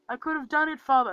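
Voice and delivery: hammed-up cry of a wronged son; pleading Loki. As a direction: imitate Loki calling desperately to his father. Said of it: "and then he's like".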